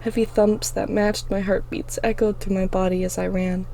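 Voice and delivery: ordinary delivery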